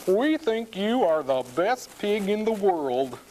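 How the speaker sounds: doting farmer couple. A narrator's reading. Farmer and wife to their small pig, doting. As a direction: gush over a beloved pig, pitched high.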